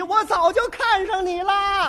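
loud high slow yell; girl shouting the wrong words in her hurry; flustered yell. Retold or imitated. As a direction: blurt it out in a panic. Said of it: "一着急".

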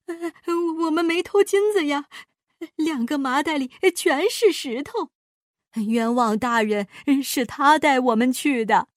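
distressed, protesting accused man; anxious plea to the judge; pleading innocence. A narrator's reading. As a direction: cry out that you've been wronged. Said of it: "两个流浪汉直喊冤枉".

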